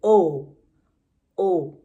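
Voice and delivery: O said loud and clear, twice; teacher repeating O for infants; long O sound. Shown as a demonstration. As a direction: model the O vowel for the class. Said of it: "They make the sound".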